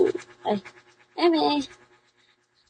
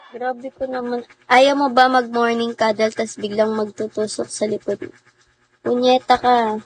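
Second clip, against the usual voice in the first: normal talking voice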